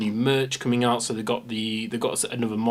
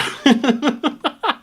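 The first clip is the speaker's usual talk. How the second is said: high laugh; 6 dB louder than usual. Real, not acted. Laughing at feet joke.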